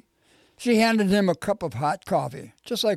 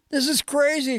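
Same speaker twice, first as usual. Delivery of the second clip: understated narrated yell, pitched well up; mild shout; 8 semitones higher than usual. A narrator's reading. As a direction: call it out in a lifted voice. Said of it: "shouted Terrence".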